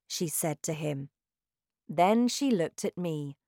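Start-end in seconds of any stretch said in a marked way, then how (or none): none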